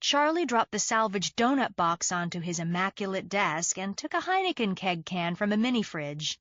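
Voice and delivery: usual speaking voice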